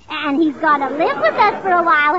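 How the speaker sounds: ordinary talk, nothing marked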